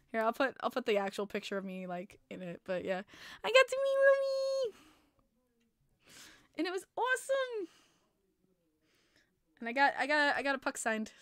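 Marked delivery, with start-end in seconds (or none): 3.4-7.7 squealing about meeting her favorite player; fangirl squeal. Real, not acted. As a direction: squeal the name like a thrilled fan. Fan squealing again.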